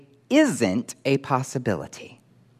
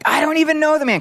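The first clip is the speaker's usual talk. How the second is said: hugely emphatic, loud, high-pitched denial; Peter; 6 dB louder than usual. Acted. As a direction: deny it in a high outburst. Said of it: "Hugely emphatic".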